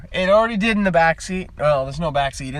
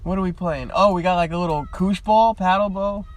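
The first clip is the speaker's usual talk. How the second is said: normal talking voice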